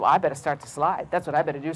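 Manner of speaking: deep, softened snake. A narrator's reading. Snake deciding to join the flight, low soft voice. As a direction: say it low and quiet.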